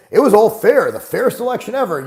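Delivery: high, loud sarcastic parroting; mocking fake praise. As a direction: sneer it like a fake slogan. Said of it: "if I don't come out and say".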